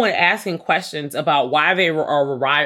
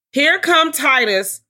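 mock announcement; 10 semitones higher, 4 dB louder, slower than usual.

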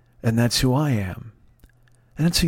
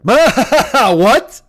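high, loud, laughing "what"; loud incredulous outburst; 11 semitones higher, 8 dB louder than usual. Retold or imitated. Brothers react to Alyssa's tearful confession, loud mocking disbelief. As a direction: burst out in mock disbelief, much higher.

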